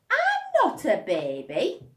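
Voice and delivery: put-on high, loud, slow toddler protest; indignant toddler. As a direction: insist like an offended toddler. Little one protests.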